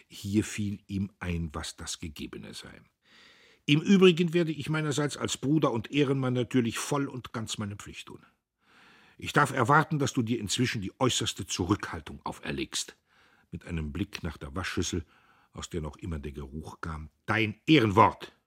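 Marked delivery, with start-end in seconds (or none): 3.7-13.0 higher, louder, stiffly formal; self-important man of honour; pompous brother. A narrator's reading. Diederich poses as man of honour. 17.2-18.4 louder, insistent; stern demand. A narrator's reading. Diederich demands her word.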